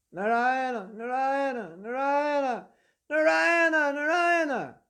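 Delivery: desperate scream for his son; drawn-out, high-pitched panicked calling; frightened screaming. Acted. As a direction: cry out the name in terror, high and loud. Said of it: "As he's screaming".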